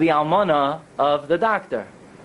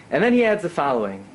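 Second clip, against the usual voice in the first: usual speaking voice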